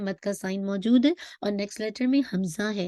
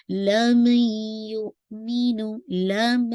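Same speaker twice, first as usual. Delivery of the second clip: slow chanted लम युमिनु with nasal merge; Quran recitation; 4 dB louder, much slower than usual. Shown as a demonstration. Teacher recites the phrase for her class, slow chant. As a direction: draw it out in tajweed style. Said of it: "मीम पे फतह है".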